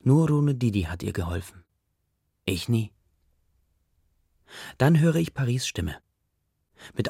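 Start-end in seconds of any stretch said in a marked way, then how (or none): none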